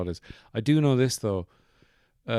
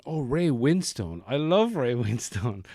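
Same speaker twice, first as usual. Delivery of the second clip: mock swooning fan, higher and swoopy; gushing movie fan; 4 semitones higher, 4 dB louder than usual. Host imitates young fan of Ray Winstone, gushing. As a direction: gush like a smitten young fan, half laughing. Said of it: "twenty-one-year-old movie fan going".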